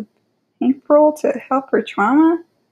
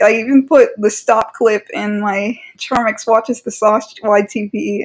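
ordinary talk

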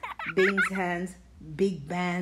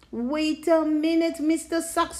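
higher and louder, cutting in; indignant interruption; 8 semitones higher, 5 dB louder than usual. Acted. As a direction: stop him mid-rhyme. Knox interrupts the fox.

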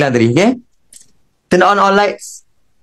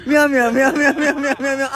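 higher meows with a laugh in them; laughing cat meows; 8 semitones higher than usual. Retold or imitated. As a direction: meow through giggles. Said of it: "Uncle tu kucing".

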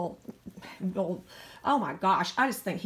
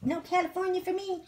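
voicing the dog; 7 semitones higher than usual.

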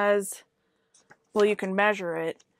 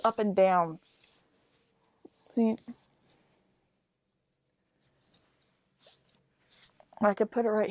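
muffled behind fabric mask; through a face mask. Real, not acted. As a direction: mouth covered by cloth. Seen 3: cloth mask covers mouth and nose throughout; off-face in refs.

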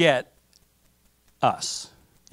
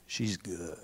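very low, soft murmur; hushed admiration; 9 semitones lower, 14 dB quieter than usual. Acted. Adam first seeing Eve, low hushed approval. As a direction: drop deep and quiet, savoring it.

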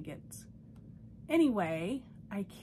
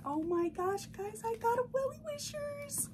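very high squealed announcement; excited squeal; 13 semitones higher than usual. Real, not acted. Telling viewers about her new doll, high squeal. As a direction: squeak it out, thrilled.

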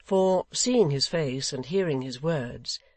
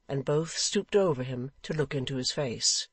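ordinary delivery, nothing marked

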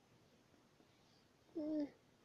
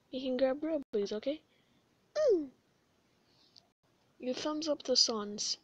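ordinary delivery

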